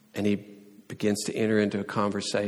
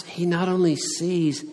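normal talking voice

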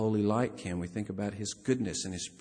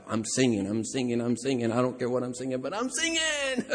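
mock excitement; 2 semitones higher than usual. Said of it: "They're just excited about".

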